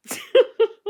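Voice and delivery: high, loud burst of giggling; a squealing laugh at her own blunder; embarrassed laugh. Real, not acted. Admitting she mistook a cameraman for David Walliams, squealing embarrassed laugh. Embarrassed confession of mistaken identity.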